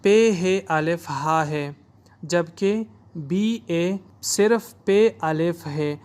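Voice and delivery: پے ہے الف ہا spelled out slowly and deliberately; teacher spelling slowly; slow spelled contrast. Shown as a demonstration. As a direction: spell each letter slowly to show the difference. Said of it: "فرق یہ ہے".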